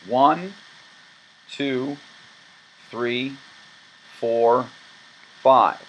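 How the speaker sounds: slow, spaced counting aloud; deliberate teacher count; counting group numbers. Shown as a demonstration. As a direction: count each group out clearly. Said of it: "From the beginning".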